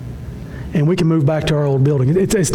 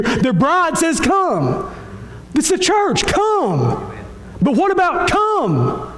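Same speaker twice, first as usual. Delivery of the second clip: high, ringing invitation with falling cries; impassioned call; 9 semitones higher, much slower than usual. Real, not acted. Preacher calling the whole congregation in, impassioned. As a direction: call out high, stretching each short appeal.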